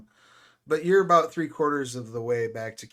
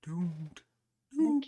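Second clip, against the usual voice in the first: ominous whisper; 6 semitones higher, 3 dB quieter than usual. Acted. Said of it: "he looks up and goes".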